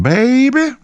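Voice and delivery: put-on very high, drawn-out girly caricature; imitating her voice. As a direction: squeal it sweetly in a put-on woman's voice. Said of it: "this is how she talked".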